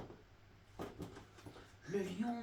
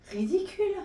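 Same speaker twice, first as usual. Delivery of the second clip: scornful; 10 semitones higher, 5 dB louder than usual. Acted. Elephant scoffs.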